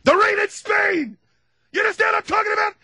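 very high, loud drilling shout; hammed-up shouting tutor; professor shouting. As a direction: bark the lesson at her. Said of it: "the professor and he's teaching her how to talk".

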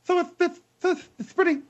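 squeaky cracking high voice. Acted. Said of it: "see what I'm doing?".

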